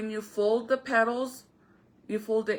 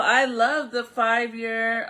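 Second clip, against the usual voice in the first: usual speaking voice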